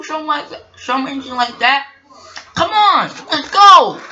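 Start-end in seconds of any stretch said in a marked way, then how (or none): 2.5-4.0 high, loud rallying shout; urgent call. Acted. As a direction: urge them to get moving. Engine urges the others to go.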